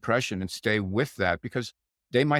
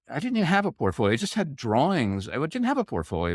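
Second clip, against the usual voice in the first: pleading; 6 semitones higher than usual. Retold or imitated. Retelling his plea to boss.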